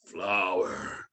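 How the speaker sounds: low, slow mock villain grunt; deep-pitched, halting caricature; Bane imitation. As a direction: mimic a dim brute, drawn out and low.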